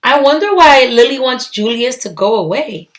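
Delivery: ordinary delivery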